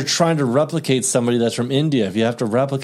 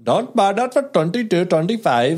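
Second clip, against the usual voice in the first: higher, put-on Indian accent; the Indian accent everyone does, mocked to show a friend; 7 semitones higher than usual. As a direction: imitate the cliché Indian shopkeeper accent. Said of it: "that's the Indian accent that everyone always does".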